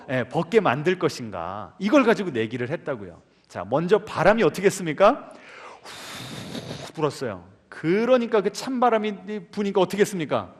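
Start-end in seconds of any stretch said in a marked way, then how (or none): 5.8-7.4 breathy wind blow. Retold or imitated. Retelling the wind's blowing.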